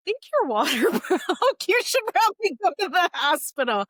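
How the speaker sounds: high-pitched, laughing imitation of a coworker; acted-out coworker. Coworker telling her to go to hospital, high laughing imitation.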